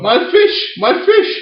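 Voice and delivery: very high, loud hammed-up wail; mock distress. Imitating the girl whose fish was shot, shrill mock distress. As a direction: imitate a girl shrieking in panic. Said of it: "the girl's like".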